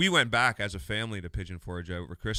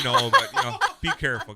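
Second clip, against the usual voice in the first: laughing; 9 dB louder than usual. Real, not acted. Laughs at the joke.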